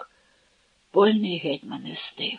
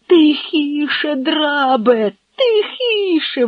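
high, tense, slow rebuke; angry hush; 7 semitones higher, 4 dB louder, much slower than usual. Acted. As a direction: cut the servant off, furious. Hetman silences shouting servant.

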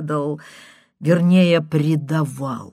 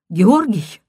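breathless, rushed woman on the phone; a stranger rushing her question; hurried woman's voice; 3 semitones higher, 6 dB louder than usual. A narrator's reading. Woman caller asking in a hurry. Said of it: "спросил прерывистый, торопящийся голос женщины".

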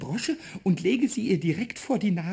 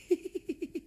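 impish giggle; 10 semitones higher, 8 dB quieter than usual. Acted. Pumuckl giggles after poem.

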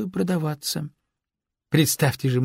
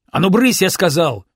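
loud, higher-pitched shooing shout; yelling; 5 semitones higher, 7 dB louder than usual. A narrator's reading. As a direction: shout them off like naughty kittens. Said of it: "заорал я". (громкий окрик, прогоняя)

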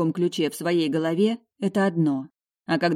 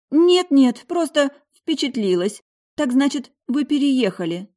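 lightly voiced mother, higher and louder; a mother caught off guard, pitched up; mother's voice; 9 semitones higher, 6 dB louder than usual. A narrator's reading. Mother answering her daughter, pitched-up mother voice.

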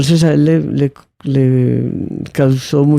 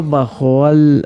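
normal talking voice

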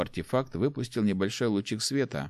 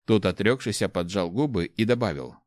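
ordinary delivery

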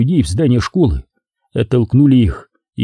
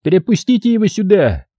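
high-pitched order; high, raised command; commanding call; 8 semitones higher than usual. A narrator's reading. Commander orders guards to let him through.

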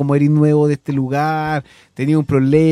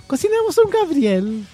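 very high-pitched mock cooking-show voice; squeaky put-on tutorial host; instructional video host; 11 semitones higher, slower than usual. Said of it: "van a hacer un video así instructivo".

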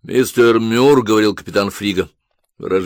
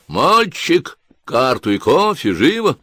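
shouting into a tube; 6 semitones higher, slower than usual. A narrator's reading. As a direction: yell the order into the tube. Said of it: "Мюр крикнул в переговорную трубку". (крик в переговорную трубку)

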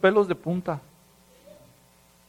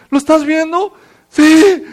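pregunta aguda y un sí gritado; susto actuado; 10 semitones higher, 11 dB louder, slower than usual. Acted. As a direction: exclama alarmado, muy fuerte y agudo. Acts out disciples seeing the ghost. (discípulos espantados, en tono exagerado)

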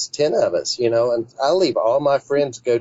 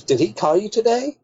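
a much higher, lightly voiced Alta; quoting Alta; 10 semitones higher than usual. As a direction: toss the question up high in her voice. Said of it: "She goes".

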